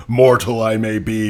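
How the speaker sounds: normal talking voice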